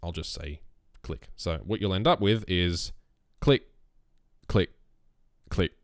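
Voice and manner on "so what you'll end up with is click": ordinary talk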